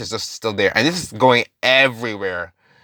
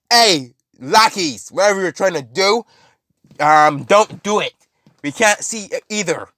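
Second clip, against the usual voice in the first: imitated shouting; 6 semitones higher than usual. Villain shouting at his lackeys, mock shouting. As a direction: call out to underlings, acted annoyance. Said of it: "shouting at them".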